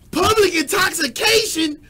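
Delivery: slow, loud, high disbelief on public; repeating the charge, outraged and loud; outraged shout. Real, not acted. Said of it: "let me calm down".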